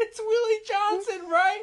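high squeaky laughing voice. Real, not acted.

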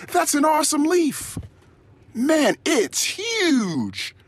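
high, slow gushing praise; high, drawn-out gushing over a leaf; laying it on thick. Retold or imitated. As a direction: overdo the enthusiasm for a toddler. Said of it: "I lay it on thick".

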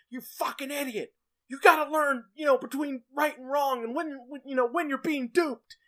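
high, loud mock scolding; scolding yell. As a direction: yell at him like a fed-up elder. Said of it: "it's like".

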